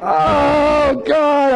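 high, drawn-out mock-anguished cry; comic replay of panic; freaking out. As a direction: wail it high, half laughing at your own panic. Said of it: "I'm, like, totally freaking out".

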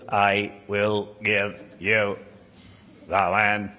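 "I will give you the land" in a low, slow monotone; every word from "I" to "land" equally unstressed, dragged out; toneless inflection. Shown as a demonstration. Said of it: "I don't think it was".

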